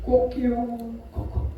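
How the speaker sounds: soft imitated rooster crow, trailing off. Preacher imitating the rooster's crow, softly. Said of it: "닭이 두 번을 물었습니다".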